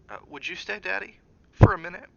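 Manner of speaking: put-on the child timidly pleads with his father; shy young boy. Boy's voice, shy.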